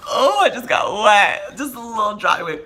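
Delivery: high, loud, breathless thrill; giddy excitement. Real, not acted. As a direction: gush it, overexcited. Giddy after the accent.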